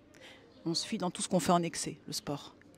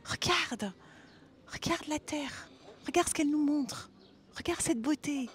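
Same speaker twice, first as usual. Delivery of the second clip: higher, marvelling voice pointing out beauty; bright-eyed, higher wonder; showing wonder; 7 semitones higher than usual. Shown as a demonstration. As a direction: show a child something amazing. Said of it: "je vais te dire: Regarde".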